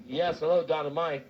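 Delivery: ordinary talk, nothing marked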